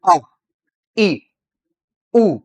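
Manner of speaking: अ, ई, उ each said alone, cleanly, with a pause after; clear vowel demo. Shown as a demonstration. Teacher sounding out vowels अ, ई, उ for students. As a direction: sound out अ, ई, उ for the class to repeat. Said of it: "आप भी मेरे साथ उच्चारण कर सकते हैं".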